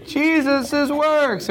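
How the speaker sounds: very high, slow singsong cheer; exaggerated enthusiasm. Teacher echoing the class's answer with exaggerated enthusiasm. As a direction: trumpet it out with hammed-up glee.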